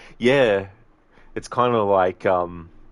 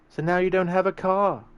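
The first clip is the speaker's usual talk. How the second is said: high-pitched second character; movie sidekick voice; 12 semitones higher, 4 dB louder than usual. Acted. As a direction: voice the action hero's sidekick, pitched way up. Woman's part in acted movie scene.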